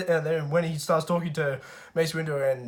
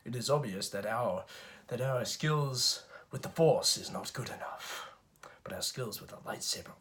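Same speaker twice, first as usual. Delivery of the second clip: low and soft, fading to a whisper; hushed Dooku; 3 semitones lower, 7 dB quieter than usual. Retold or imitated. Count Dooku facing off with Yoda, low and fading to a whisper. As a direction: let the line sink into a whisper at the end. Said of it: "Count Dooku's just like".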